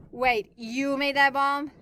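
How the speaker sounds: loud, slow disbelief; shocked. A narrator's reading. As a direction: stretch out the shock. Shocked at the bomb reveal.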